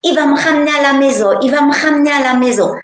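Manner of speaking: put-on a child relieved to be going home; happy little girl. Little girl telling the husband Jesus takes her home, excited child voice. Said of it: "Puis je dis".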